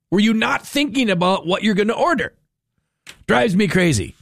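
ordinary talk, nothing marked

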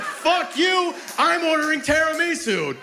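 high-pitched indignant comic rant; hammed-up high, furious yelp; mock outrage. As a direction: refuse the waitress in exaggerated outrage. Acted outraged reply to waitress.

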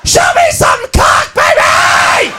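drawn-out raucous holler; wild girl yell. Acted. Repressed girl cheering the stripper, wild raucous shout. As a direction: holler it like a crazed club-goer. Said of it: "She's going".

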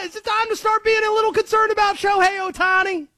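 high, worked-up conspiracy guy rant; hammed-up high conspiracy caller, anxious and suspicious; Conspiracy Josh voice. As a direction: rant like a jittery conspiracy theorist.

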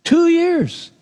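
Two years high and stressed; stunned at the delay, high and emphatic. Shown as a demonstration. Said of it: "The butler forgot for two years".

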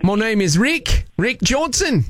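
broad put-on Aussie accent; Australian accent. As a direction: greet them in a hammed-up Australian accent. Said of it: "I've got a killer Australian accent".

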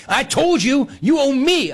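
high, insistent demand; aggressive talker. Retold or imitated. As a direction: mimic a pushy gambler's claim. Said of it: "really aggressively".

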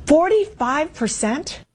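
a news host stunned by a poll figure; incredulous. Acted. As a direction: let the number hang in shocked disbelief. Host reads the shocking poll figure.

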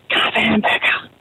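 hushed library whisper; slow secretive whisper for a joke punchline; whispered order. Acted. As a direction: whisper it slowly, as if not to disturb readers. Patron told it's a library, whispers.

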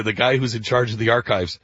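normal talking voice

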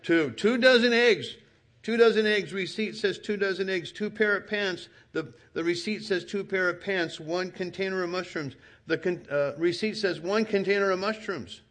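high, emphatic itemizing, each item hammered; high insistent recital of the receipt; insistent listing. Retold or imitated. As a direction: prove every item, point by point.

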